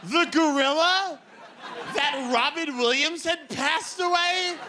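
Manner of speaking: high-pitched comic exasperation; incredulous rant. Acted. Comedian questions telling a gorilla, incredulous. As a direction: push it up high and stay there, aghast.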